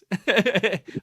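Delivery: loud high laugh; burst of laughter. Real, not acted. Laughing while addressing JT.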